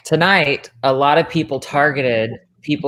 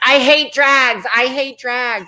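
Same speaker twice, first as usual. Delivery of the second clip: mock whiny Brent; 10 semitones higher, 3 dB louder than usual. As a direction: mimic a complaining friend, whining. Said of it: "being like".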